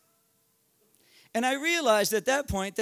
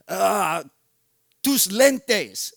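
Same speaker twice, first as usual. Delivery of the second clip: robber's demand; 9 dB louder, much slower than usual. Retold or imitated. Robber barks a demand in Spanish.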